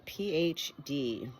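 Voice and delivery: plain reading voice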